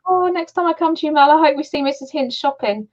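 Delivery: high, bubbly retold excitement; excited. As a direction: squeal it like a thrilled fan. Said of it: "I was so excited when I found that out".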